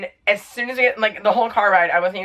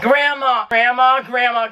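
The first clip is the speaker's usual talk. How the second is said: high mock yell for Grandma; retold scream; 5 semitones higher, 3 dB louder, slower than usual. Grandchild screaming for grandma from the floor, retold scream. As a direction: imitate urgent, repeated calling out. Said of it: "started screaming for Grandma".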